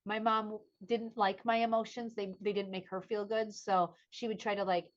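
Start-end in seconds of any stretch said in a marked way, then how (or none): none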